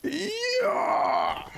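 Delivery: long, high-pitched attack yell; battle cry. Pretend. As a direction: hold a high "hee-yah" as you swing. Battle cry during attack in game.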